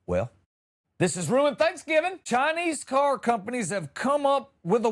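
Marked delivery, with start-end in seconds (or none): 0.1-2.2 hammed-up gloom, the end pitched higher; a defeated man by his flat tire; mock tragic sigh of a line; mock despair.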